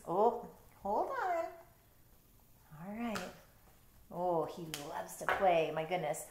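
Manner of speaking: ordinary delivery, nothing marked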